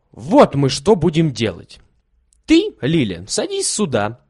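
put-on excited scheming boy. A narrator's reading. Kolya plans the organ-grinder game, excited and bossy. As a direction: lay out the game eagerly.

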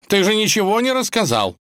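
high, loud complaint; indignant protest. A narrator's reading. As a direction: protest at being cheated. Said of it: "возмутился кот".

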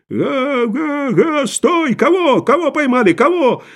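high-pitched excited calls; crowd clamour. Acted. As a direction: call out high like an agitated crowd. Crowd clamours during the chase. (возбуждённые выкрики толпы)

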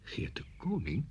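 near-whispered, soft question; hushed recall. Acted. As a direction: murmur the name while searching your memory. Hesitant recall of a pupil's name. (zacht, bijna fluisterend navragen)

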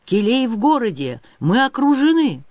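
loud, high alarmed report; shouting on the run. A narrator's reading. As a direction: shout the warning with rising urgency. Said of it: "кричал он на бегу".

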